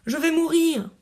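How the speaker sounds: high, anguished cry of despair. A narrator's reading.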